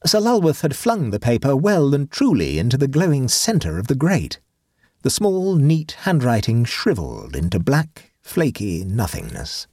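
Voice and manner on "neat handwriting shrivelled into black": usual speaking voice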